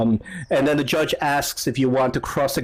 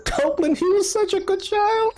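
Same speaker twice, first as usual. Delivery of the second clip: very high wailing mother, breaking into sobs; squeaky, put-on weeping voice; mock sobbing. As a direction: wail through hammed-up tears. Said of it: "halfway sobbing while she's saying this".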